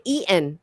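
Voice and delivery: EATEN with the T swallowed, ending in n; dropped-g eating. Shown as a demonstration. American showing her own way, EATEN with dropped g. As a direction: say EATEN as eat-n, no final g. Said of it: "I say eaten".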